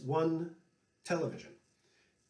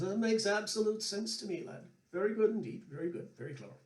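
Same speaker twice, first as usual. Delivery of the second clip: put-on higher, genial old Irish fiddler; old Irishman; 6 semitones higher, faster than usual. Paddy praising the host's TV art, mild Irish lilt. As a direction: approve warmly in a lightly put-on Irish lilt. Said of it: "looks over and says".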